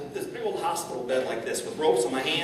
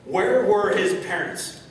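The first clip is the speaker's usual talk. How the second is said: high, loud, slow accusing cry; bitter blame; 7 semitones higher, 5 dB louder, slower than usual. Pretend. Imagined reaction blaming the parents, loud accusing cry. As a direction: hurl the blame outward.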